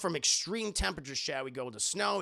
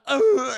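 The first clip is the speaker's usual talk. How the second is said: mock disgusted ugh; 8 semitones higher, 13 dB louder than usual. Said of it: "she's like".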